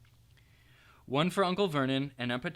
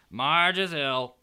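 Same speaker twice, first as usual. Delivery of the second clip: Uncle Vernon informing; 8 dB louder, much slower than usual. A narrator's reading. Vernon reading postcard.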